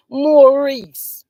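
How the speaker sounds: scornful name-calling. Acted. Vlogger scornfully calling out Maurice's name, loud. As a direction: spit his name out in disgust.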